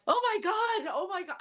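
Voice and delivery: high-pitched mock panic; panicked bystanders. Startled passengers reacting to a man with a gun, mock panic. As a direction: imitate frightened bystanders, high and fluttery.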